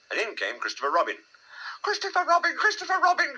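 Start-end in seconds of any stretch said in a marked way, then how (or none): 1.8-3.4 very high, squeaky call; crying out. A narrator's reading. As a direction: cry urgently for help in a little voice. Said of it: "cried Piglet".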